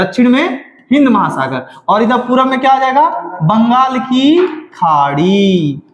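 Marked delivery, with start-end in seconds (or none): 3.4-5.8 बंगाल की खाड़ी very slow, with stretched vowels; drawn-out dictation. Shown as a demonstration. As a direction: stretch out Bengal की, pause, then a long खाड़ी. Teacher drills new name slowly.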